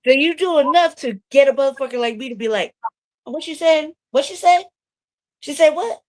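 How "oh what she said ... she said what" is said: high, rising repeated questions; mock outrage, pitched up and asking for it back; incredulous. Warrior being wound up for battle, incredulous. Acts out being provoked into disbelief.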